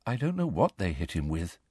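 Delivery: soft, low, downcast; sad. A narrator's reading. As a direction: say it quietly with mild sorrow. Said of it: "said Luna sadly".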